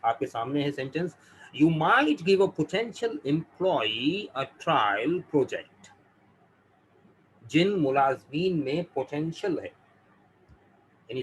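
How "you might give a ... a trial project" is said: very slow, careful reading of the sentence; teacher dictating slowly; slow example read. Shown as a demonstration. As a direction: read the example word by word for learners. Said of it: "गौर से सुनिएगा".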